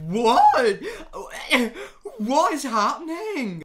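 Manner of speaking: high, loud laughing shriek of disbelief; laugh-scream it in shock; cackling, stunned disbelief; shocked laughter. Real, not acted. Shocked laughing at an HIV post.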